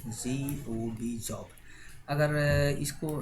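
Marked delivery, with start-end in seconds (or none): 0.1-1.5 letters spelled slowly one by one; teacher spelling for class. Shown as a demonstration. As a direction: dictate each letter slowly. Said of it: "कोई बोला जॉब".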